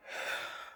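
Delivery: long, voiceless exhale; real sigh to steady the crying; tearful sigh. Real, not acted. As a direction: breathe out heavily between tears. Crying while telling bad news.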